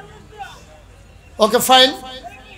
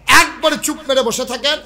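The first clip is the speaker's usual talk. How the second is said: loud, high-pitched command to the crowd; raised, insistent voice; real stern order; 8 semitones higher, 8 dB louder than usual. Real, not acted. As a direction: call out sharply to keep them seated. Ordering restless audience to sit.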